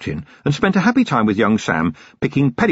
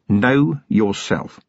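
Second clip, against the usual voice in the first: drawn out, weighty; a touch of mock solemnity; slow quoted maxim; much slower than usual. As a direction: lay the words down one by one. Vimes quoting a thinker.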